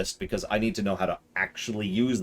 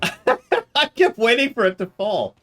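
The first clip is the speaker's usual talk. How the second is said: laughing, high and slow; 12 semitones higher, 5 dB louder, slower than usual. Real, not acted. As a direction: speak through the laugh. Laughing after stream glitch.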